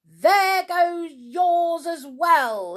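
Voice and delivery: high, loud, drawn-out squeal. A narrator's reading. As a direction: squeal it in furious spite. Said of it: "he squealed".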